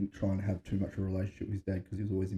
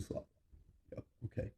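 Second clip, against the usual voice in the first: barely voiced, mild mock mutter; deadpan under breath; 15 dB quieter, faster than usual. Theo shrugging off a stare, muttered deadpan. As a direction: toss it off under your breath. Podcast guest deadpan reply.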